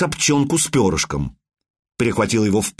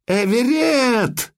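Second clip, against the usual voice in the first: desperate cry; 12 semitones higher, much slower than usual. Acted. Mother screams for drowning son.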